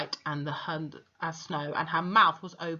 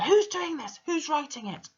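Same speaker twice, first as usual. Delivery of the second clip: much higher yell thrown at the whole room; 10 semitones higher than usual. A narrator's reading. Headmistress yelling at the class, high yell. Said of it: "she yelled at nobody in particular".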